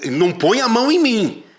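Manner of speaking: high, loud angry warning; loud protest. Retold or imitated. Teller imitates the singer yelling at him to back off. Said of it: "e ele falava alto".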